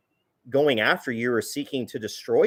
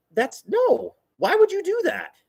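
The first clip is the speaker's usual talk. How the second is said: incredulous. Real, not acted. Incredulous at the imagined heckler.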